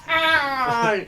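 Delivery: sharp high cry of pain, mimicked; imitated pained cry. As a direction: mimic a tall guy hitting his head. Coworker smashes head.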